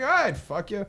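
retold sarcastic come-on. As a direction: replay it as an obvious joke. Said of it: "I said it very sarcastically".

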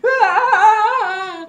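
very high, loud ecstatic scream; excited scream. Retold or imitated. Running off with an autograph, ecstatic high scream. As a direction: scream with joy, running up the hill.

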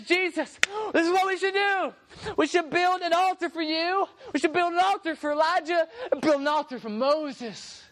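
high, eager gush of ideas, acted; getting excited. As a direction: pitch the plan high like an overexcited disciple. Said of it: "And he's getting excited".

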